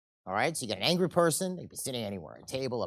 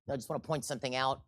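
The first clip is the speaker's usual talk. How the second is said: normal talking voice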